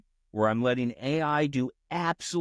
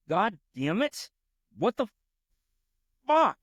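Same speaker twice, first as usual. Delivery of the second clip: mock angry typing; 10 semitones higher than usual. Shown as a demonstration. Coder demonstrating the swearing he types at the AI, hammed-up. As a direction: build to a hammed-up yell on fuck. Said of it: "everybody kinda laughs when I do this".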